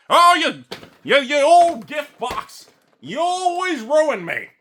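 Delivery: put-on high, slow, wailing old-man scold; mock cranky old man. Mocking himself as an old man yelling at clouds, high and slow. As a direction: wail at the gift box like a cranky geezer.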